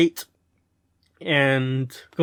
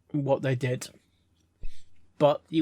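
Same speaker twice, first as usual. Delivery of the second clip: ordinary delivery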